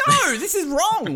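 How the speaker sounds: high-pitched, exaggerated protest; mock public outcry. People objecting to a communist state, high mock outcry. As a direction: cry it out as an indignant crowd would. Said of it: "people are gonna be like".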